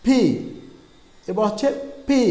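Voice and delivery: normal talking voice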